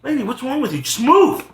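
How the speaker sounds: high-pitched exasperated outburst. Retold or imitated. Commuter to a woman blocking his way, acted exasperation.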